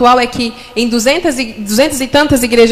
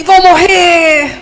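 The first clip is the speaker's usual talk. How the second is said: scared thought; 4 semitones higher, much slower than usual. Retold or imitated.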